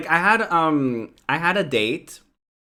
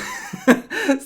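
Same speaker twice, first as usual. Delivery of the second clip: a bright burst of real laughter, pitched up; high laugh; 13 semitones higher than usual. Real, not acted. Laughing at not recognizing her own language, real.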